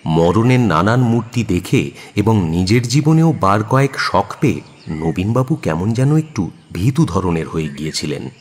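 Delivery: normal talking voice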